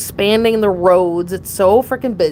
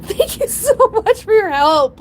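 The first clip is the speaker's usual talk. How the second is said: giggly thanks; 12 semitones higher than usual. Real, not acted. Thanking the woman publicly now.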